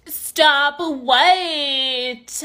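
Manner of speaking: each word held absurdly long; mocking drawl. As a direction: innocently drag out both words. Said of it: "You sound so unprofessional".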